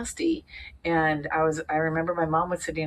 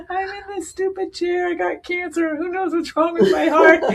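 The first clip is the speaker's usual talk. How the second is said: much higher, mock-desperate inner voice; high exasperation; 13 semitones higher, 5 dB louder than usual. In the spinning test chair, high mock despair. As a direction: whine it to yourself. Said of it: "remember thinking".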